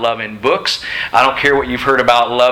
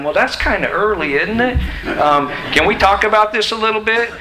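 mock-whiny soldier, pitched higher; whiny recruit; 9 semitones higher than usual. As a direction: play a recruit haggling with his officer. Mocking a soldier questioning orders.

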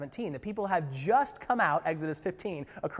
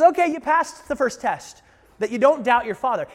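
much higher and louder sly tempter; taunting Satan; 8 semitones higher, 12 dB louder than usual. Retold or imitated. Preacher acting Satan's second dare, mocking. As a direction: egg him on, smug and pushy. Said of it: "He goes".

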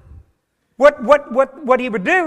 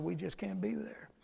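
whisper; 3 semitones lower, 18 dB quieter than usual. Retold or imitated. Whispered excuse.

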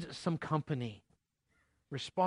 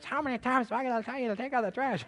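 higher, quick, exasperated parent voice; nagging parent; 9 semitones higher, faster than usual. Retold or imitated. Pastor imitating a parent nagging a kid about trash, higher and fast. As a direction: rattle it off like a fed-up mom. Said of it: "you remember your parents".

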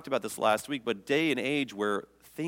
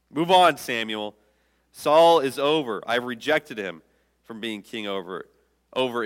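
commanding call; 6 dB louder than usual. Acted. God's voice telling Samuel.

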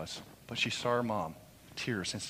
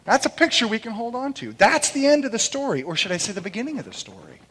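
high, loud, soaring preacher's cry; ringing pulpit proclamation; preaching at full force; 12 semitones higher, 13 dB louder than usual. Acted. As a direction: proclaim it to the back row.

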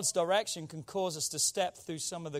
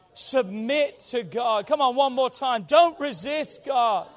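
loud, slow, weighty exhortation; emphatic preaching; 4 semitones higher, 7 dB louder, slower than usual. Real, not acted. Preacher leading the congregation to repeat after him, emphatic exhortation. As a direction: drive each word home for the congregation.

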